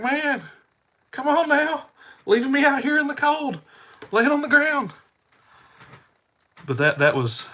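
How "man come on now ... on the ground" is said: high, loud, exasperated holler; pitched-up yelled grumble; retold screamed complaint. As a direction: re-enact griping at someone who left you lying there. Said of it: "screaming at her".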